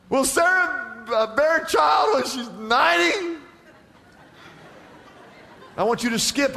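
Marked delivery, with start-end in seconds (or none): 0.1-3.4 high, disbelieving question; acted incredulity. Abraham asking himself about aged Sarah bearing a child, acted high incredulity. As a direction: let the question climb in astonishment.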